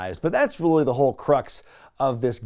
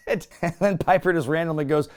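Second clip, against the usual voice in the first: ordinary talk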